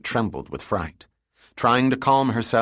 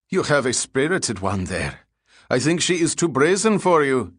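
higher, teasing jibe; taunting; 5 semitones higher than usual. A narrator's reading. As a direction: needle him, rising in pitch towards the end. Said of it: "he taunted".

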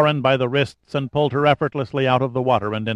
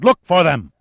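higher, louder furious shout; a harsh shout at the captain; 5 semitones higher, 6 dB louder than usual. A narrator's reading. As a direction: yell it straight at him. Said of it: "he shouted".

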